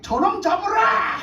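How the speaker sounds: high, loud, slow order to seize him; commanding shout. Acted. A king ordering the prophet seized, high, loud and slow. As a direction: bark it like a raging king.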